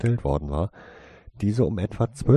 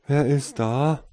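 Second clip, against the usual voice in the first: loud call put on as just woken; loud, sleepy-sounding; 8 semitones higher, 8 dB louder, slower than usual. A narrator's reading. Tasein at the door, faking a sleepy loud call. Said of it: "fragte er laut, aber möglichst verschlafen klingend".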